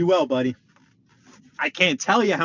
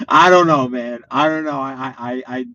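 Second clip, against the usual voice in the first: normal talking voice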